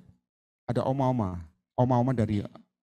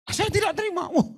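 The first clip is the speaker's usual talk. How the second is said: much higher, strange possessed voice refusing; demon voice; 12 semitones higher than usual. Retold or imitated. Spirit in the old woman defying the preacher, high and strange.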